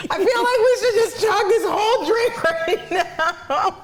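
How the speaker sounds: high, slow words squeezed out through laughter; real giggle fit, barely getting it out; laughing hard. Real, not acted.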